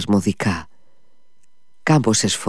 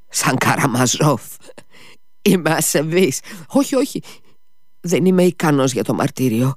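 higher, swinging between whispers and high cries; tormented Mitya; 5 semitones higher than usual. Acted. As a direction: confess in feverish torment.

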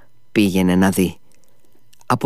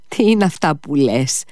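stunned, higher cry of disbelief; bewildered outburst; 6 semitones higher than usual. Acted. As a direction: blurt it out as if lost. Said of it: "τράβλησε σαν χαμένος".

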